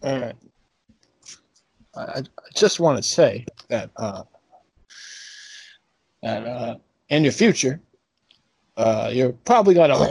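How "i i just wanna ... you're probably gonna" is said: put-on Southern accent. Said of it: "why I have a Southern accent".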